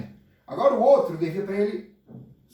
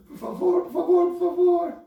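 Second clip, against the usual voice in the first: much higher, louder begging please; high pleading; 13 semitones higher, 4 dB louder than usual. Acted. Kneeling servant begs the king, high and insistent. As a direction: cry out please, pitched way up.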